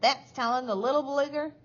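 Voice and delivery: high, loud spiteful screech; shriek. A narrator's reading. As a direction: screech your approval. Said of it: "shrieked Missus Cratchit".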